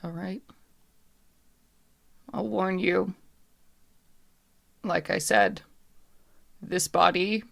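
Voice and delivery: lightly voiced captive spirit, measured and halting; guarded, weighing each phrase between pauses; wary puppet woman, reluctant warning. Puppet woman warns the dreamer of her limits, lightly voiced and halting.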